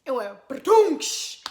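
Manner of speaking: slow, loud mock crash noise "ba-doonks"; wave crash sound. Telling friends how the wave hit, loud slow crash noise. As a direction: stretch out "ba-doonks" like a wave hitting.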